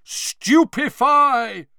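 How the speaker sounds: loud, high, drawn-out roar; roared spell. A narrator's reading. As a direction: roar it out like an attack. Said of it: "roared twenty voices".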